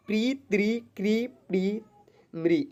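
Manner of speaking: प्री, त्रि, क्री, प्री, म्री one by one, "ree" held; syllable reading demo. Shown as a demonstration. Teacher showing how ri syllables are read, one by one. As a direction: read out each ri syllable separately, vowel drawn. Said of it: "ऐसे करके हम लोग इसको पढ़ेंगे".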